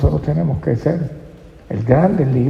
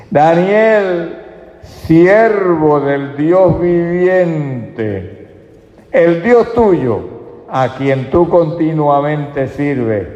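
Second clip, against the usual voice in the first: a king's sorrowful cry toward the lions' den; sad calling voice; 5 semitones higher, much slower than usual. A narrator's reading. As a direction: call out slowly, grieving for him. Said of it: "con voz triste".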